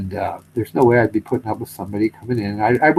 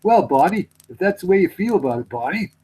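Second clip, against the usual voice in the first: higher, lightly voiced offended Luger; a hint of wounded pride; hurt Luger; 9 semitones higher than usual. Luger sulking at Barney in the retold skit, mild put-on voice. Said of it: "Luger goes".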